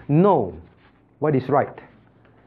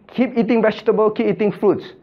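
higher-pitched, insistent parental urging; parent urging children; 7 semitones higher than usual. Acted. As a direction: lightly play a parent coaxing kids. Acts out a parent telling children.

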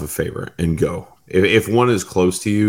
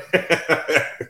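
high giggle; 9 semitones higher than usual. Real, not acted. Laugh after retold apology.

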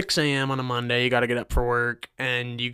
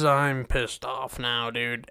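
normal talking voice

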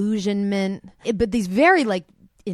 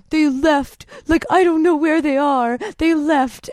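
higher and louder, a breathless panicked rush; panicked blurt; 8 semitones higher, 5 dB louder than usual. Retold or imitated. Neighbor reports the couple vanished, panicked and breathless. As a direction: gasp it out like he's just lost them. Guy panicking at her door.

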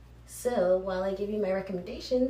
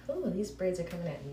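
normal talking voice

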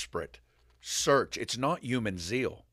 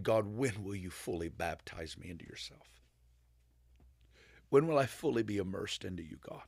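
hushed, breathy whisper of a crying prayer; tearful whispered prayer; 3 semitones lower, 6 dB quieter than usual. Retold or imitated. Retelling a man crying out to God, hushed whisper. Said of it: "and he's crying, saying".

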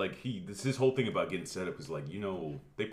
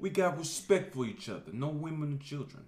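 mock Boston tough guy; 10 semitones higher, slower than usual. Mocking movie gangster.

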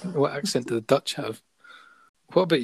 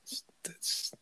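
a hushed, unvoiced aside; whispered denial; 10 dB quieter than usual. Real, not acted. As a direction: whisper it under your breath. Denying the song line, aside.

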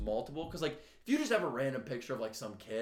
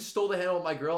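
ordinary talk, nothing marked

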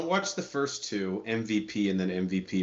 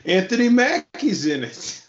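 excited; 10 semitones higher, 7 dB louder, slower than usual. Real, not acted. Hyping the movie's star.

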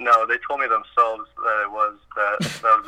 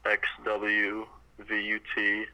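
slow alphabet recitation; 6 dB quieter, slower than usual. Real, not acted. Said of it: "Can you do the alphabet backwards for us".

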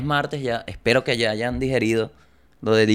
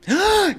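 sudden high hammed-up wail, much higher; mock wail. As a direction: burst into a fake crying wail. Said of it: "Y se puso a llorar". (lamento exagerado y agudo)

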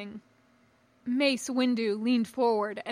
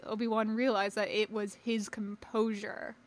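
usual speaking voice

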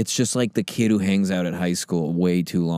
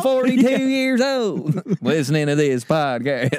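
high Southern outburst, breaking into laughter; mock Louisiana listener; 11 semitones higher than usual. Middle-aged listener offended by podcast, mock Southern accent. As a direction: play an indignant Southerner, pitched high. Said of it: "four people from Louisiana that listen".

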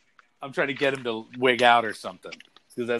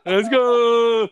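loud, high, stretched-out GO; big drawn-out cheer with laughter; mock hype cry; 9 semitones higher, 8 dB louder than usual. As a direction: echo the hype yell while cracking up. Echoing the hype catchphrase, laughing.

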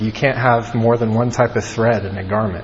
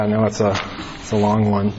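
normal talking voice

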